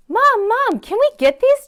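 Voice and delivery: put-on high, eager pleading kid voice; pleading little rabbit. Little rabbit begging Mom for underwear, high pleading. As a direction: beg mom like a small child. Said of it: "Jasper pleaded".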